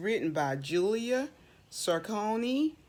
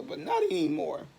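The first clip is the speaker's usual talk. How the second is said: usual speaking voice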